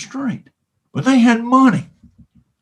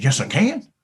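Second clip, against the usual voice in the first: higher, put-on cow voice, matter-of-fact; playful talking-cow voice; 7 semitones higher than usual. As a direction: insist like a stubborn cartoon cow. Cow Bessie argues.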